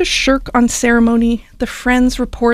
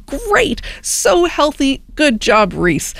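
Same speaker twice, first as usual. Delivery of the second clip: mock praise; 5 semitones higher, slower than usual. Imagined listener praising healthy salad.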